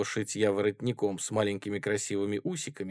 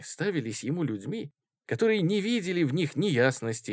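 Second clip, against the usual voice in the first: usual speaking voice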